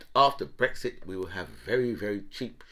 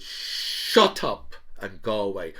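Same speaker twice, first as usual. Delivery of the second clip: drawn-out dismissal; 4 dB louder, slower than usual. Retold or imitated. Drawn-out mock dismissal of Welby.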